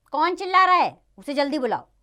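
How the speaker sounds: loud, high-pitched royal demand; imperious demand. Acted. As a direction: call out sharply as an annoyed king. King demands who is shouting.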